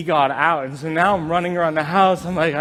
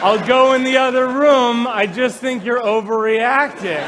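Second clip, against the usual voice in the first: raised, louder mock huff; put-upon roommate; 4 semitones higher, 4 dB louder than usual. Mouse roommate leaving after being pushed off couch, mock-offended. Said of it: "like a roommate".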